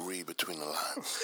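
low, slow, coy: Read between the lines; sly low murmur, slow; low sly hint. Real, not acted. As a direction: drop the voice and say it slow and knowing. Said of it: "That's how you say it?".

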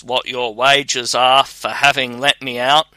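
usual speaking voice

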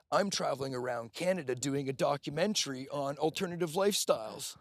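high, loud, excited Australian impression; imitated Australian accent. Retelling a traveler revealing her documentary, imitated Australian accent. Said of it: "with this Australian accent".

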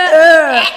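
loud, high groan of revulsion; disgusted "ugh". Real, not acted. Friend reacting to a cheesy chat line, disgusted groan. Disgust at being called baby.

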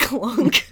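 loud heavy clunk sound. Retold or imitated. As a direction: say clunk like a lock dropping. Imitating big clunk.

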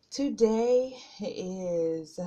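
breathless, slow start from nerves; nervous and winded, halting; out of breath. Real, not acted. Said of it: "I'm feeling out of breath".

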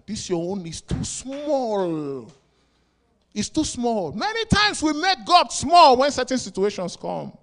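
high, loud, slow build; rising preaching. Acted. Preacher raising his voice to the congregation. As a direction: drive the point home to the church.

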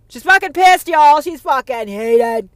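high, loud shout. Real, not acted. Hyping up the audience about her rage, shouting. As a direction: belt it out to the crowd.

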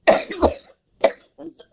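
apologizing through a tickly throat; coughing, throat catching on the words; frog in throat. Real, not acted. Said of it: "I got a tickle in my throat".

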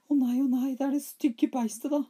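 put-on very high, loud frightened boy. A narrator's reading. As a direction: squeal it like a scared child. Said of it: "sa Smørbukk".

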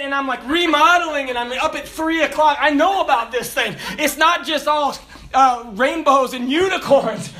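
preacher laughing about his debts, high and loud; laughing loud preaching. Acted.

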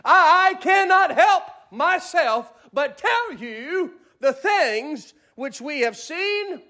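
climbing, high, full-force preaching; rising preacher cadence. Acted. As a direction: build the sermon to a peak. Preaching quote of Peter.

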